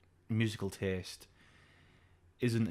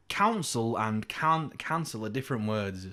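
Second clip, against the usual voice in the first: slow correction. Retold or imitated. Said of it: "I'm like".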